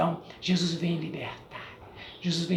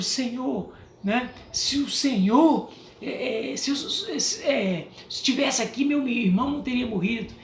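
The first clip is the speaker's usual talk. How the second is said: higher, pleading and halting; pleading sister; 6 semitones higher than usual. Retold or imitated. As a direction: raise the voice like a grieving sister pleading. Irmã lamenta a Jesus. (irmã enlutada suplicando, voz mais alta)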